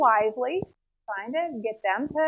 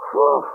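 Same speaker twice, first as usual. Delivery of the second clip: loud blown-out breath of relief; relieved whew; 7 semitones lower, 10 dB louder than usual. Retold or imitated. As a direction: blow out hard, mock relief. Acted relief after escaping the battle.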